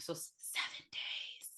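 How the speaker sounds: slow, breathy eerie whisper; creepy whispered countdown. Acted. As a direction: hush it like a horror-movie warning. Spooky Samara reference, seven days.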